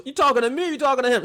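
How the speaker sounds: mocking imitation in a New York Italian accent; mock Italian-American tough guy. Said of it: "Johnny the Bull goes".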